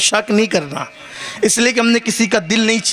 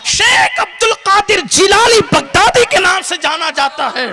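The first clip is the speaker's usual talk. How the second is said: full-force preaching; 11 semitones higher than usual. Acted. Preacher praising Ghaus Pak to the crowd, impassioned high preaching. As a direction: raise the whole hall with rising fervour.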